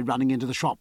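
usual speaking voice

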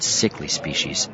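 ordinary delivery, nothing marked

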